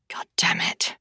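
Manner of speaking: a breathless whispered curse under the breath. Acted. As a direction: mutter it to yourself in a whisper. Narrator's private curse under breath.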